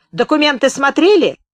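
high, loud demanding question; shouted interrogation. A narrator's reading. Gunman interrogating the journalist about papers, loud and high.

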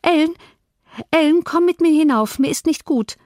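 put-on high, urgent girl's voice; distressed girl. A narrator's reading. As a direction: call the nurse away in distress. Upset girl calls nurse away.

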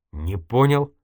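slow, mildly baffled, rising at the end; puzzled. A narrator's reading. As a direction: say it like a bewildered question. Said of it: "озадаченно произнёс журналист".

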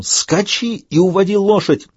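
high, slow urgent cry; desperate shout. A narrator's reading. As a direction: urge him to flee in despair. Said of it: "в отчаянии крикнул он".

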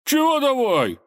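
very high, loud, huffy retort; indignant. A narrator's reading. Robber offended by the tsar's dare, indignant. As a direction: squawk back in indignation. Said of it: "возмутился соловей и насупился".

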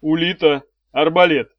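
loud, curt order; commanding call to a subordinate; cold command. A narrator's reading. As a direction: summon the weapon with icy authority. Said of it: "холодно приказал Арей".

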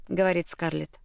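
plain reading voice

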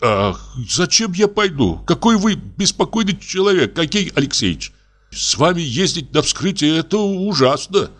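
high, fretful doctor's voice; exasperated doctor. A narrator's reading. Doctor exasperated with restless investigator, high fretful. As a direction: throw up your hands at him.

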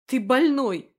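high, loud barked insult; girl barking an insult at her captor. Acted. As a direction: bark it right at him. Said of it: "рявкнула она".

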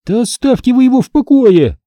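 high, forceful call; shouting. A narrator's reading. Hannah to boys closing in on Alex, shouting. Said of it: "крикнула им Ханна".

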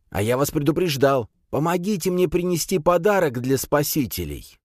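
high, insistent priest voice; priest character. A narrator's reading. Priest answers the warrior, high zealous voice. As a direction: proclaim it high, full of zeal.

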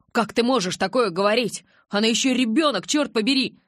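loud, high indignant protest; shocked, heated objection; shouting. A narrator's reading. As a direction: raise your voice in outrage. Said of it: "Джейкоб тоже перешёл на крик".

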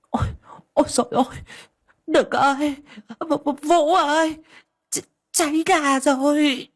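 put-on very high, stammering old woman calling for help; high-pitched alarm, stumbling over words; panicked cry. A narrator's reading. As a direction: cry out the fire alarm, drawing out the last words. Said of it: "Bà cụ kêu lên".

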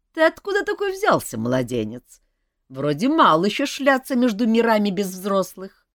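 put-on high, loud amused elf voice, teasing the boy; a bemused grown-up elf laughing at a kid. Elf teasing the runaway boy, high and loud.

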